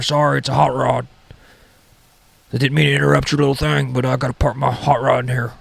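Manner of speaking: put-on a fussy old guy barging into the garage; crazy old guy. Old roommate interrupting to park his car, fussy put-on voice. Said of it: "I live with this crazy old guy".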